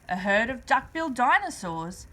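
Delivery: usual speaking voice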